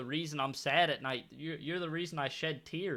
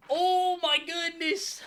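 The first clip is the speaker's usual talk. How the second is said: exasperated groan; 10 semitones higher, 6 dB louder, slower than usual. Real, not acted. Opponents about to score again.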